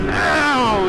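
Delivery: sharp high ow of pain; pained yelp. Real, not acted. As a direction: yelp as a rock hits. Hit by rocks while riding.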